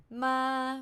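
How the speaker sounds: "ma" held level; flat tone. Shown as a demonstration. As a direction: keep "ma" straight, without movement. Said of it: "these are the five Thai tones".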